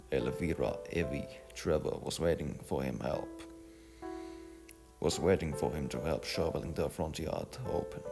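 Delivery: usual speaking voice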